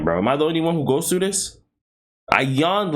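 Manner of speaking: usual speaking voice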